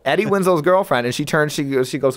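ordinary talk, nothing marked

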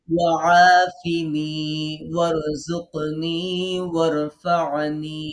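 ওয়াআফিনি ওয়ারজুকনি ওয়ারফা'নি drawn out slowly, syllable by syllable; slow recitation demo. Shown as a demonstration. Teacher models the new phrase slowly for students. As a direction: recite each word slowly so the class can copy. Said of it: "পরের তিনটা মুখস্থ করবো".

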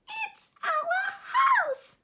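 very high, loud squeaking mouse shout; squeaked. A narrator's reading. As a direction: shriek it like a tiny mother mouse. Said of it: "squeaked the mother mouse".

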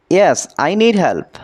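very slow, careful English; slow model sentence. Shown as a demonstration. Teacher modelling English for Bengali learners, very slow and careful. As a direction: stretch every word for learners. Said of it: "এখানে yes মানে হ্যাঁ".

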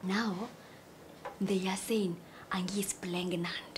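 slow, near-whispered confession; low-volume, conspiratorial reveal; hushed gossip. Acted. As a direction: drop right down and confide the secret. Confiding a scandalous rumour to a friend.

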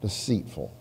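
ordinary talk

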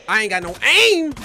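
high, loud wail of frustration; exasperated shout. Real, not acted. Player missing his shots, exasperated shout.

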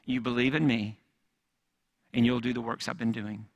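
ordinary talk, nothing marked

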